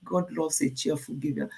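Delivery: normal talking voice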